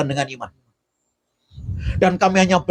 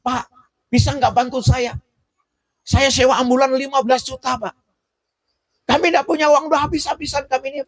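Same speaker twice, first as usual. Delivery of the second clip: lightly acted high-pitched begging; retold desperate plea; 11 semitones higher than usual. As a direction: imitate a relative pleading for help on the phone. Retold family pleads by phone for help.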